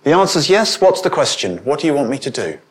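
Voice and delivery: keen servant answering at once; eager readiness. Acted. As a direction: jump in willingly before being asked. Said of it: "It's that sense of".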